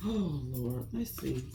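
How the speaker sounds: normal talking voice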